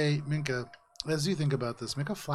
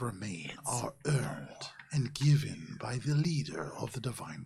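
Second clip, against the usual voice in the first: whispery, stately history lesson. Acted. Javaris tells Valis how armor is earned, whispery stately mystic. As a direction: unfold old lore solemnly, phrase by phrase. Game master voices Javaris.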